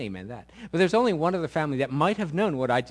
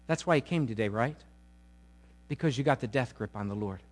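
ordinary delivery

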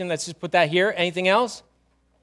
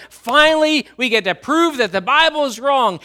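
mock-eager skeptic, high and loud; high, loud, triumphant quote; gloating skeptics; 6 semitones higher, 6 dB louder than usual. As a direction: crow it like a doubter who finally gets the chance. Acted skeptics gleeful at chance.

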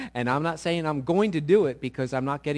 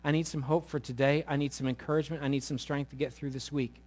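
quiet prayer; 4 semitones lower, 9 dB quieter than usual. Acted. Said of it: "Because you said".

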